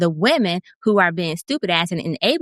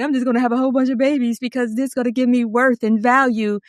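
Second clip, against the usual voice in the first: lightly put-on voice, pitched higher; mock self-justifying; 4 semitones higher than usual. Retelling a man's reason for many babies, mock self-justifying. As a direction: mimic someone justifying himself, voice raised.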